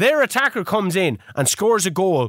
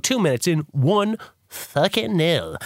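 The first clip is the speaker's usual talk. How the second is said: ordinary talk, nothing marked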